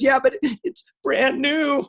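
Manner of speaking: high, slow tearful whine; mock crying. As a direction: imitate a crying teenager dragging out the words. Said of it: "And she's still crying".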